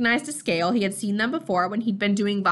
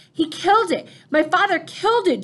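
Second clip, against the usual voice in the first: higher, louder shocked cry; stunned outcry; 8 semitones higher, 5 dB louder than usual. Acted. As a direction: blurt it out in horror. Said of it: "stunned at what he was realizing".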